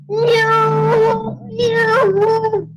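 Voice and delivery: high cat meow. Acted. Acting as a kitty cat.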